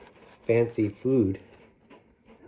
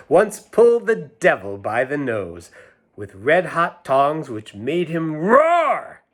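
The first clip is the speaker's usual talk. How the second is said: sing-song nursery rhyme reading; lilting storybook recital; 2 semitones higher, 4 dB louder, slower than usual. A narrator's reading. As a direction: read it like a rhyme to children. Reads rhyme aloud.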